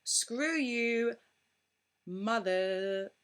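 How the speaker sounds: mock street insult, loud and harsh; mock curse. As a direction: spit it out like a stranger cursing you in the street. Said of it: "cursed in the street by strangers".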